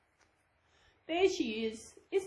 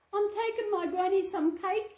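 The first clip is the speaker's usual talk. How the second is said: put-on high, bright little girl; 9 semitones higher than usual. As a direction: answer like an innocent child. Girl answers the wolf.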